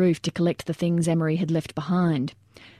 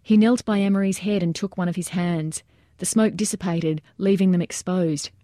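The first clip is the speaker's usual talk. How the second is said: ordinary delivery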